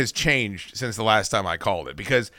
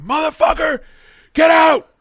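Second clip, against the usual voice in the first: much higher, loud furious yell; all-out threatening yell; 6 dB louder than usual. Retold or imitated. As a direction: scream it at the bushes. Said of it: "I'm yelling like that".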